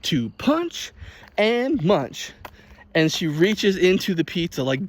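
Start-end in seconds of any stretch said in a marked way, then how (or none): 0.0-2.3 high, slow hammed-up pitch; mock grandma ad voice. Imitating the grandma's ad line, high and slow. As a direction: stretch it like an ad line. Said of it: "she's like".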